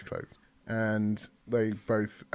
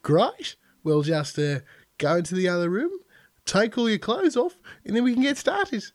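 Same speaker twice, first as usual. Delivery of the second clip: Australian receptionist; 8 semitones higher, 3 dB louder than usual. Acted. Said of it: "Yeah, she's Australian".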